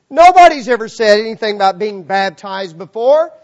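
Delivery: loud, high-pitched pulpit proclamation; full-force preaching. Acted. Preacher telling the church of instant obedience, full-force preaching.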